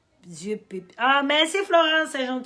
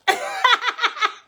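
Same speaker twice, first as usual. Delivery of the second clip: high laugh; 12 semitones higher, 3 dB louder than usual. Real, not acted. Laughs at "évite ou évite.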